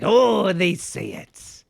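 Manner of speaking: high, loud, slow crone wail; old crone voice. Retold or imitated. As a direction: drag it out like a cackling old hag. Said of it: "like an old crone".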